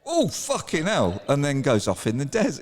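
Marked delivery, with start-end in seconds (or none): 0.0-1.2 high, slow mock groggy curse; lightly voiced dazed kangaroo. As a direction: act a kangaroo coming round, drawn out. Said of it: "shakes its head and goes".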